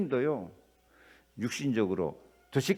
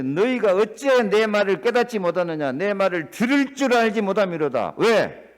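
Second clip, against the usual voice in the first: loud, preached scripture reading; 5 semitones higher, 7 dB louder than usual. Acted. Pastor reading the verse aloud to his church, proclaiming.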